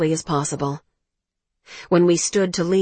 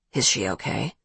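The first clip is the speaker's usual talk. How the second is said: low, soft male voice; 8 semitones lower, 4 dB quieter than usual. A narrator's reading. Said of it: "Brody's voice was low".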